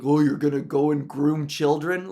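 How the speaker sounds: sneering snide question; mock the accusers with contempt; mocking Twitter commenter. Mocking online commenters' groomer accusation. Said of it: "Too many people being like".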